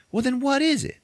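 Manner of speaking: very high, mock exasperation; exasperated demand. Marcus asks why she refused, exasperated. Acted rejected date demands reason.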